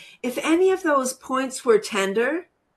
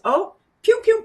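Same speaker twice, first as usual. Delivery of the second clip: much higher, squeaky pew pew; brain voice; 13 semitones higher, 5 dB louder than usual. Retold or imitated. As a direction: play the delighted brain squeaking. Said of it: "our brain says".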